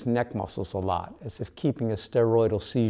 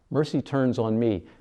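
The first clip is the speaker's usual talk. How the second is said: ordinary delivery, nothing marked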